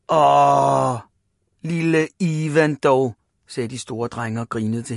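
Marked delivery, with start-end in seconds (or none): 0.1-3.1 slow, drawn-out mock sympathy; mock pity. A narrator's reading. Big boys to little Ivan, mock pity. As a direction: pity him teasingly, slowly.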